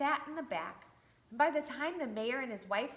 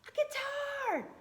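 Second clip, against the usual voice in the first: much higher, slow, thrilled cry; light up at the gift; high delighted storybook shout; excited shout; slower than usual. Acted. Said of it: "Splint was just as excited".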